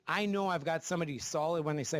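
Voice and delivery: ordinary talk, nothing marked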